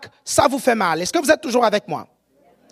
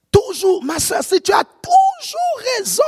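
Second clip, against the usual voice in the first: mock exasperation; 8 semitones higher than usual. Preacher chiding a sister who's always right, mock exasperation. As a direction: cry it out high in exaggerated disbelief.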